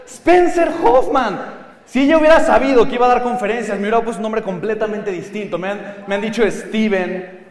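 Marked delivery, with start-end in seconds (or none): 0.1-1.4 slow careful name. Shown as a demonstration. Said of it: "difícil de pronunciar".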